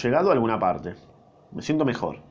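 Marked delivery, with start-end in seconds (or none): none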